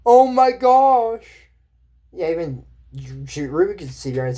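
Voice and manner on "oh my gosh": drawn-out, high, loud gasp of amazement; hammed-up astonished cry; amazed onlooker. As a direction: act out wide-eyed astonishment, stretching the words. Acting out amazed onlookers after gasp.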